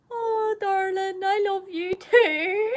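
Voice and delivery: high, very slow, doting coo; fond mum. Retold or imitated. Mum answering her daughter after surgery, fond. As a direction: imitate a mother soothing a soppy child. Mum's fond reply, imitated.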